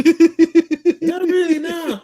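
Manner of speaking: squeaky drawn-out voice, laughing; high slow mock voice. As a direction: stretch it out high and silly. Mocking his own earlier answer.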